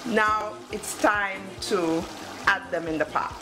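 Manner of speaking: ordinary talk, nothing marked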